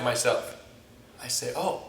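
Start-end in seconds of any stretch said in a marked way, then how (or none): 1.6-1.8 softer, low musing to oneself; self-justifying inner voice. Acted. Senior student justifying roughness to himself, quiet musing. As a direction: reason it out quietly, as a private thought.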